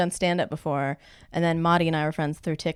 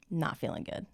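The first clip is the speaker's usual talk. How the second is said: unvoiced whisper; 11 dB quieter than usual. Retelling hangover.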